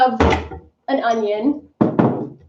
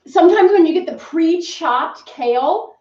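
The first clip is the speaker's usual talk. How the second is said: ordinary talk, nothing marked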